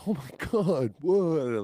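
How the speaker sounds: high, loud astonishment; starstruck. Retold or imitated. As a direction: exclaim as the realization hits. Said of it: "starstruck in the moment".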